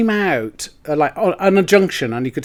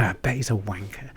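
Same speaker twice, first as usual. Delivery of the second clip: low, soft inner aside; whispered thought; 7 semitones lower, 11 dB quieter than usual. Retold or imitated. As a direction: say it quietly to yourself. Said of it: "You know that you".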